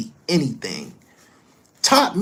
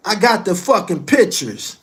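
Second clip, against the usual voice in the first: louder and higher, hammering got; heated, raised-voice insistence; angry shout; 7 semitones higher, 8 dB louder than usual. Real, not acted. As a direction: yell it to end the argument. Angry climax of the rant.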